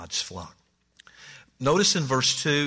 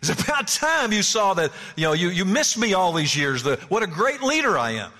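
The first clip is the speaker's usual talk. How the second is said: higher-pitched mock self-congratulation; self-important complaint; 5 semitones higher, 3 dB louder than usual. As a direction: play a man overdue for recognition, voice raised in pitch. Acts self-important man demanding recognition.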